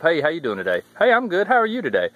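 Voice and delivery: mock cheery southern greeting; friendly southern ritual. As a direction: play the chirpy southern hiker. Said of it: "you got the ritual of".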